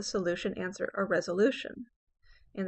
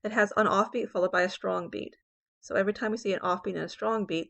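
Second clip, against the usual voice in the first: ordinary talk, nothing marked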